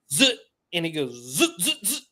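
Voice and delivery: quick zipping bursts; imitated line noise. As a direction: mimic line zipping off the reel. Imitates the hooked tarpon's run.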